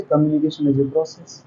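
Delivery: very soft, under-the-breath demo; barely audible. Shown as a demonstration. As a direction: speak too quietly to be heard. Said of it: "तो आपको सुनाई नहीं देगा".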